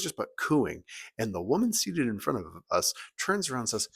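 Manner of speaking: usual speaking voice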